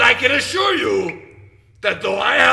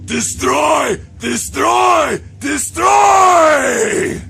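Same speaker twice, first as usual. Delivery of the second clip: building shout; 7 semitones higher, 6 dB louder, much slower than usual. Acted. Rallying the moshers, chanted shouts building to a roar. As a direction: chant rising to a full roar. Chanting commands at mosh show.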